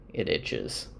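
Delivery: low, soft murmur; quiet reply. Retold or imitated. Husband quietly answering about his beard, low and subdued. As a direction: say it quietly, dropped well down. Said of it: "quietly, he's like".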